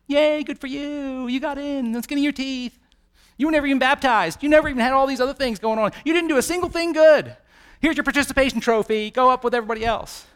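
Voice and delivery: high-pitched sarcastic cheer; patronizing, singsong high praise; mock congratulations. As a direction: hand out a worthless prize with fake enthusiasm. Sarcastic voice handing out participation trophy.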